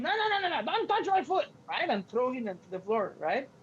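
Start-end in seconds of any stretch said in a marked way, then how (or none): 0.0-1.5 high, playful mock protest; Yoda. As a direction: squeal in mock alarm, on the edge of laughing. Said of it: "Yoda makes fun".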